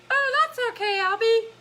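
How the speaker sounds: very high puppet voice; Elmo voice. Acted. Elmo reassuring Albie after an accident, high Elmo voice. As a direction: squeak it out as a consoling puppet. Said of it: "Elmo says".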